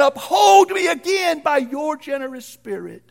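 high, loud pleading cry; desperate plea. Acted. Preacher crying out David's prayer, high and loud. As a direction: beg God not to take it away.

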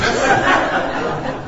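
short unvoiced laugh; breathy laugh. Real, not acted. Preacher chuckling at the watch mishap. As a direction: laugh softly under the breath. Watch assistant interrupts sermon.

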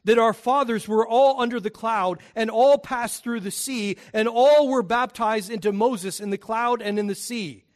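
solemn public reading; scripture reading. Acted. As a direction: read the passage out to the congregation.

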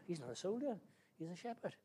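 ordinary talk, nothing marked

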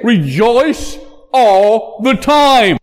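slow, high stress on ALL THE TIME; emphatic command. Acted. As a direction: punch out "all", "the", "time" one by one. Preacher contrasts with conditional rejoicing.

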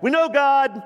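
mock pious claim. Mocking hypocrites' claim.

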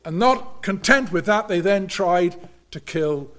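measured pulpit preaching, rising on key words. Acted. Preacher warning the congregation about unbelief, earnest sermon delivery.

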